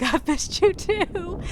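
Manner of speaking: high, slow call back; high-pitched reply. Retold or imitated. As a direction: stretch it out to a passing friend. Calls back to friend across group.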